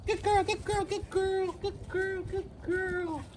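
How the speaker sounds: high squeaky dog praise, repeated. Acted. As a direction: keep the dog engaged with a bright squeak. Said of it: "I'm gonna use my squeaky voice".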